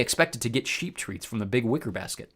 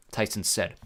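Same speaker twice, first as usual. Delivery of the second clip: ordinary delivery, nothing marked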